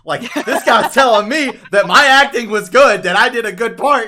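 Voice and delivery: high, loud, laughing through the words; amused disbelief, laughing out loud; laughing at the kid's praise. Retold or imitated. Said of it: "Bill Skarsgård was like".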